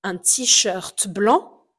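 un t-shirt blanc, slow, blanc ending on the nasal vowel; drawn out, let blanc end without a consonant; slow masculine form. Shown as a demonstration. Teacher contrasts the masculine form for learners, slow. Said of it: "au féminin, devient blanche".

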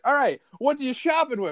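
high, loud game show host bark; mock Guy Fieri ambushing a shopper; Guy Fieri impression. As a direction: jump on the shopper like a hyped TV host. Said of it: "It's like".

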